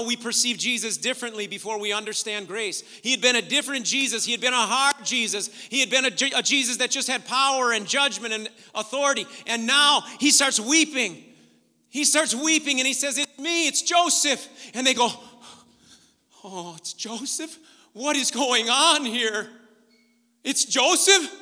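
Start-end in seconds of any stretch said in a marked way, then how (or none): 3.0-13.2 loud, raised, building retelling; forceful preaching. Real, not acted. Preacher telling how Joseph changed toward his brothers, forceful preaching. 13.2-14.5 high, loud acted outburst; impassioned reveal. Joseph revealing himself to his brothers, impassioned. 16.4-21.3 slow disbelief rising to loud; acted astonishment. Brothers discovering it is Joseph, acted astonishment.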